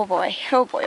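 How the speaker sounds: ordinary talk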